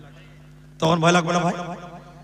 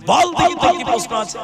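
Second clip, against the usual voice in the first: forced, slightly raised challenge; barely managed bravado; 3 semitones higher, 5 dB louder than usual. Retold or imitated. Preacher somehow managing his fear before the boy, forced bravado. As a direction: push it out as if hiding the nerves. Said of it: "কোনো রকম করে manage করে বলছে".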